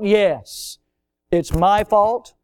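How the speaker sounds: usual speaking voice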